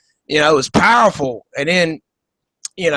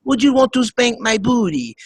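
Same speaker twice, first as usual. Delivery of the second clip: high, coy mock Eastern European accent; hammed-up seductress with a Slavic accent; Melania Trump; 6 semitones higher than usual. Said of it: "That's how she talks".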